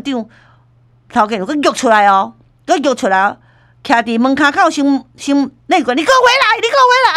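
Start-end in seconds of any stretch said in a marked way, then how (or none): 5.9-7.1 very high imitated calling out; shouting after him. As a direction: yell it after someone walking off. Manager calling the man back.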